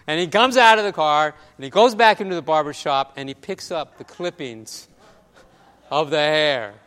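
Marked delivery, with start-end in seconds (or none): none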